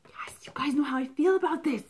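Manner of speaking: breathy whisper, rising toward the end; whispering excitedly to viewers; whispered aside. Real, not acted. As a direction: let the bee news slip out in a hushed thrill. Excited aside about bees.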